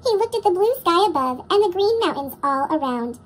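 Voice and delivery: usual speaking voice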